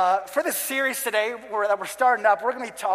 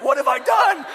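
high, loud cry of horror; hammed-up alarm at your own disaster; mock panicked shout; 7 semitones higher, 7 dB louder than usual. Retold panic at the fire.

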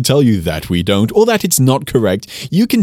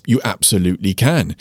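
normal talking voice

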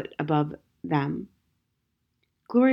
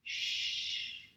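a soft unvoiced shh; hush; 13 dB quieter than usual. Madame Matinee hushing the crying boy, whispered. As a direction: soothe him with a breathy hush.

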